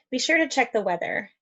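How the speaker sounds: ordinary delivery, nothing marked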